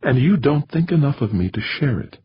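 pained, high-pitched reproach; almost sad. A narrator's reading. Woman reproaching her secretive lover, almost sadly. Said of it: "almost sadly".

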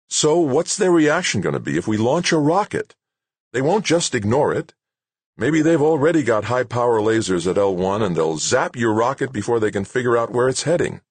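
high-pitched, insistent director laying out the danger; urgent character voice, pitched well up; exasperated base director. Acted. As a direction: argue back as an official under pressure. Narrator voices director challenging him.

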